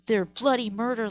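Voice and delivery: normal talking voice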